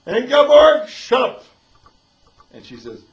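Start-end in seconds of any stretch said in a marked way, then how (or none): 0.1-1.4 very loud, high-pitched outburst; explosive acted command to be silent; exasperated shout. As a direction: cut the complainer off with a full yell. Cuts off the acted patient's complaints.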